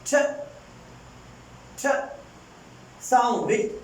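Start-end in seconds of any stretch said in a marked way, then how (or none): 0.1-1.9 ठ said with a breathy puff; aspirated retroflex t. Shown as a demonstration. Teacher demonstrates the T sound. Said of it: "Sound which it is producing is".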